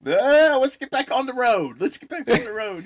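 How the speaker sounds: high, loud frantic urging. Retold or imitated. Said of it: "He's like".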